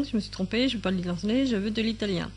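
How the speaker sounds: normal talking voice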